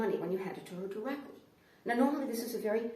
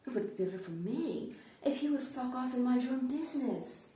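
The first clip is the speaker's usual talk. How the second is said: higher-pitched mimicry of her demand, turned rude; retort; 4 semitones higher than usual. Man answers the agitated woman on the street corner, mock-polite retort. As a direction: throw the polite phrasing back at the stranger. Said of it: "And I'm like".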